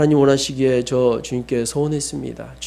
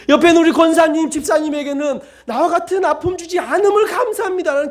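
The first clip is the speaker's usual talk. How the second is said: grateful prayer; 9 semitones higher than usual. Shown as a demonstration. Model prayer of thanks offered to God, high and fervent. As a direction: pray it up high with fervour. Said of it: "기도가 나와야죠".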